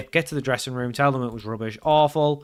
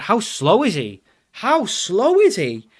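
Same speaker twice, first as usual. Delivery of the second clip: higher, louder, increasingly drawn-out complaint; exasperated disbelief; 8 semitones higher, 5 dB louder, slower than usual. Real, not acted. Player watching his striker plod towards goal, exasperated. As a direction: repeat it slower and higher in disbelief.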